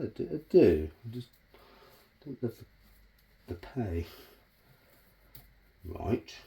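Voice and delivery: usual speaking voice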